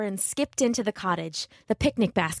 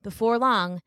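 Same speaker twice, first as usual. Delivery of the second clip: ordinary delivery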